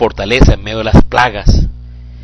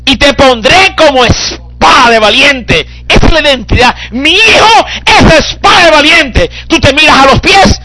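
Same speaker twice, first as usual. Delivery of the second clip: higher and louder, fervent proclamation; preaching at full force; 12 semitones higher, 8 dB louder than usual. Acted. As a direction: declare it loud to the congregation. Preacher builds to a shout. (predicación ferviente, más aguda y fuerte)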